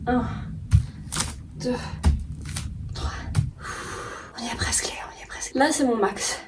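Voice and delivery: breathy whisper at the limit; strained whisper. Real, not acted. Holding the split at her limit.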